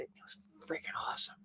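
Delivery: quick, hushed whisper; whispered rave. Real, not acted. Streamer confiding about yesterday's cheesesteak sandwich, whispered rave. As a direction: drop into a fast, delighted whisper.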